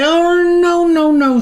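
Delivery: high, held-out minion cry; mock cry of alarm. A narrator's reading. Snuffer crying out at the minion, high and loud. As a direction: squeal it out as the club rises. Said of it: "Snuffer cried".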